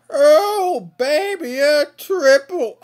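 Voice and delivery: high, loud hype yell; excited shout. Real, not acted. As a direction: celebrate the triple kill. Excited at triple kill.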